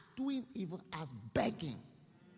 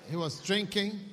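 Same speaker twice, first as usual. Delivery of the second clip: loud emphasis; 4 semitones higher, 8 dB louder than usual. Acted. Preacher denouncing the rich man, loud. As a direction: punch it out loud and high.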